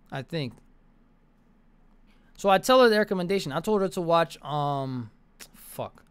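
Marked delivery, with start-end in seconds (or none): none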